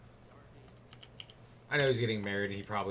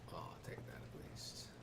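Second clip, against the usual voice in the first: soft sneaky whisper; much softer, breathy sneaky aside; 6 semitones lower, 15 dB quieter than usual. Real, not acted. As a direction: whisper like a thief in the dark. Whispers while looting.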